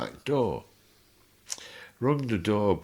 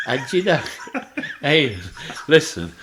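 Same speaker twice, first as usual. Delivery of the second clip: laughing; 7 semitones higher, 8 dB louder than usual. Real, not acted. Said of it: "laugh heard on lines".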